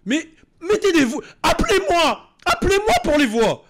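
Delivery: loud, high indignant rant; exasperated shout. Real, not acted. Streamer ranting loudly at French dubbers.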